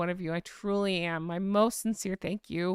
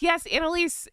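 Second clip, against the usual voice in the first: raised, higher voice calling out; yelling across; 9 semitones higher, 4 dB louder than usual. Real, not acted. Streamer answering Annalise from a distance, yelling across. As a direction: call over to someone across the way. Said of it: "I'm yelling across".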